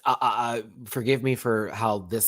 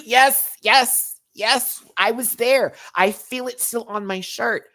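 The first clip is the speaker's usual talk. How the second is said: exasperated yes; 12 semitones higher, 6 dB louder, slower than usual. Retold or imitated. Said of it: "that lady looked at me like".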